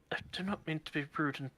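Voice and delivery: fast, timid whisper; nervous whisper. Acted. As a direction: hurry the apology under your breath. Akari nervously addressing the catfolk.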